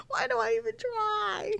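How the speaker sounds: high, slow despairing whine; exasperated whine. Real, not acted. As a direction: wail it in despair. Exasperated mock lament.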